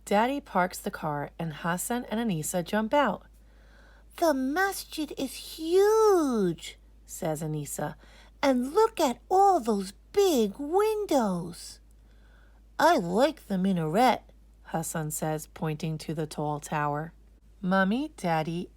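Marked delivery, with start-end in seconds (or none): 4.2-6.8 put-on high, slow, wide-eyed child voice; little girl voice. Anisa arriving at the masjid, amazed little girl. 8.4-11.8 put-on high, slow, wide-eyed child voice; little girl voice. Anisa arriving at the masjid, amazed little girl. 12.8-14.2 a hint of a small boy, pleased; lightly voiced little boy. Hassan admiring the tower, lightly voiced boy.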